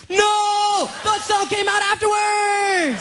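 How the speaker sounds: high-pitched protesting yell; hammed-up shouted self-defense. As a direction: wail a drawn-out denial. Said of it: "He goes".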